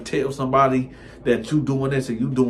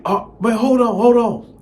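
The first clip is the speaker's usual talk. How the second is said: high, loud flustered rush; 12 semitones higher, 8 dB louder than usual. Retold or imitated. Boyfriend caught out, stalls at the door, high and loud. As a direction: hurry to stall them.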